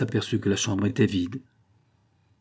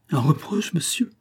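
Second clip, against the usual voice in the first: sharp, quick and higher; quick retort; 7 semitones higher than usual. A narrator's reading. Andrée snapping back at the count, quick and high. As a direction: fire it back at once. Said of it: "reprit vivement André".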